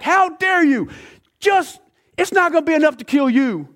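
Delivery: high-pitched outraged shouting; acted fury, voice shooting way up; freaking out. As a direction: explode with indignation at being defied. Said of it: "He's freaking out".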